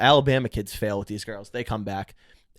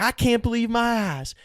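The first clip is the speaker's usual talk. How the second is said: mock Southern disbelief, pitched way up; Southern guy; 11 semitones higher than usual. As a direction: put on a high Southern guy voice. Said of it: "these Southern guys".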